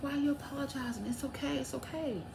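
high, very soft and quick comforting; sweet reassurance. Retold or imitated. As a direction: imitate a kindly nurse soothing a patient. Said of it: "she was so sweet".

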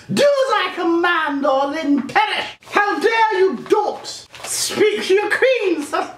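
high, slow domineering queen; evil queen voice. Pretend. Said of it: "running Lucasfilm like the queen".

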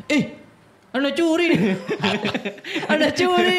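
high-pitched, laughing mock complaint; mocking mimicry. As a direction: squeal the accusation teasingly through laughter. Teasing by mimicking the theft accusation. (menirukan tuduhan dengan nada tinggi sambil tertawa)

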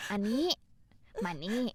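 normal talking voice

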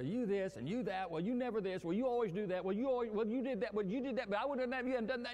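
mock argument. Preacher acting out a couple's argument, mock heated.

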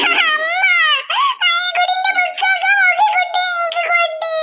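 put-on a small girl wailing a complaint; crying little girl. Maina cries to her mother that her brother spoiled her books.

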